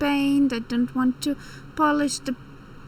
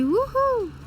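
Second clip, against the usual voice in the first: excited cheer; 11 semitones higher than usual. Real, not acted. Safe landing celebration.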